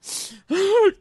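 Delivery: sharp high sobbing gasp; mock sob gasp; tearful gasp. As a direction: gulp air like a crying drunk. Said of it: "看着我说".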